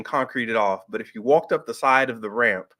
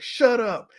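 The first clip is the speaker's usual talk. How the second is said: high, snappy retort to hecklers; retold shout, pitched up; mock yell; 9 semitones higher than usual. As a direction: imitate a wrestler yelling at the crowd. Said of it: "yelling at the audience".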